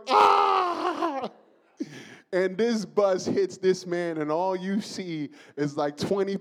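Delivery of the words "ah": very high, very loud terrified ah; man's scream. Retold or imitated. Preacher acting the man's scream before impact. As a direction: scream as the bus hits. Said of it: "the man was like".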